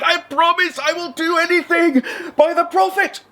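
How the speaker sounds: high, desperate pleading genie. Acted. Trapped genie begging the fisherman, high and desperate. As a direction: promise anything to get out, high-pitched.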